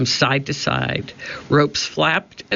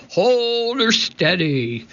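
high slow captain's shout; high pirate shout; 9 semitones higher, slower than usual. A narrator's reading. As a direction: bellow over the storm. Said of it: "shouted Cap'n Bones".

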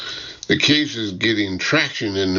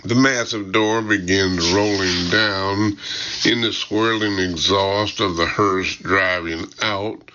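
plain reading voice

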